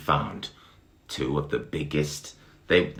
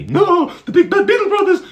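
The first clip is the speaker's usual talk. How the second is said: very high, loud squeaky voice; frightened high ladybird; 14 dB louder, faster than usual. Acted. Mister Ladybird confronted by the beetles, frightened squeak. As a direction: squeak out in alarm. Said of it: "he said".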